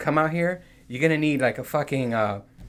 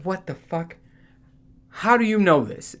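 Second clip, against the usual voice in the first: baffled; 6 semitones higher than usual. Retold or imitated. Baffled at bug knowledge.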